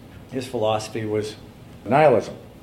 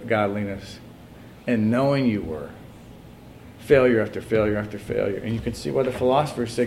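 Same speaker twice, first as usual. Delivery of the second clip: normal talking voice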